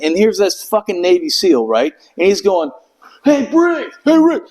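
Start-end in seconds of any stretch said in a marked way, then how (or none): 3.2-4.4 calling out. Retold or imitated. Navy seal calling out on plane.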